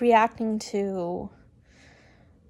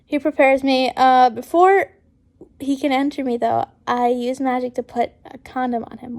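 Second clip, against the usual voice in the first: ordinary delivery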